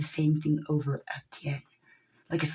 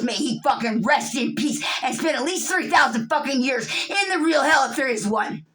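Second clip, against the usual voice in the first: angry curse; 6 semitones higher, 5 dB louder than usual. Real, not acted. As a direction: spit out the curse on them. Cursing the ambulance crew.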